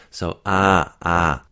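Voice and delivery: "ah" held as a wide open vowel. Shown as a demonstration. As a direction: sound out "ah" clearly, twice. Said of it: "the ah sound ... is a bit more open".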